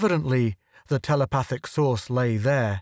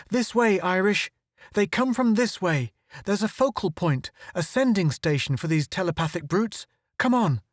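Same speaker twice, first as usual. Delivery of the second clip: high, pressing call to follow; excited rallying cry; urgent call; 6 semitones higher than usual. A narrator's reading. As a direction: urge him on over the swarm, pitched high. Urgent call through the swarm.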